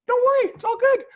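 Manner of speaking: brushing it off with a grin; breezy reassurance. Retold or imitated. Photographer reassuring Colin Firth, high breezy voice.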